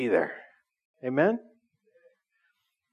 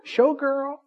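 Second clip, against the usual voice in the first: gushing fangirl; 10 semitones higher, much slower than usual. Pretend. Pastor mocking fans who would rush to see [name], high giggly squeal. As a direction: go way up and giggle like a starstruck fan. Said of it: "you would be like".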